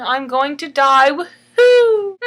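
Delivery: loud high cheer; gleeful cheer. Acted. Aretha cheering about dying, loud and gleeful. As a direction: cheer it like it's good news. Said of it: "she's saying".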